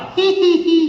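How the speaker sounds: a high silly giggle, mimicking a friend; dumb giggle. Imitating the director's dumb high giggle behind the set. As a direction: giggle like the prankster director off to the side. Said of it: "Whatever dumb laugh he has".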